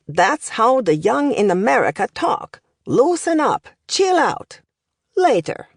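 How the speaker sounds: put-on accented professor, slang pitched high; professor showing off slang. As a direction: demonstrate youth slang like a proud tutor. Professor shows youth slang.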